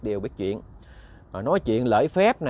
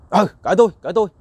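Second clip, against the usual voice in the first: put-on brash young man yelling back, much louder; defiant shout; 5 semitones higher, 8 dB louder, much faster than usual. (giọng quát lại thách thức)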